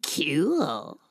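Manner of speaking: breath-only, drawn-out kyool; whispered "kewl". Acted. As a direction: whisper a long, affected kyool. Redoing the kewl line on purpose.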